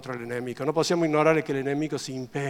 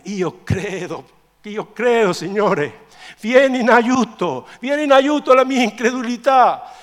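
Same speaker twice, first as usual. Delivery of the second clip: drawn-out cry swelling louder and higher; desperate cry; 9 semitones higher, 14 dB louder, slower than usual. Acted. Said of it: "questo è il grido, questa disperazione".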